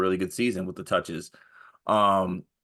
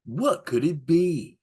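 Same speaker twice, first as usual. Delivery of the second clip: slow, dramatic movie trailer narrator; 8 semitones higher, slower than usual. Acted. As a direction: tease the mystery like a trailer. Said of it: "like a movie trailer voiceover guy".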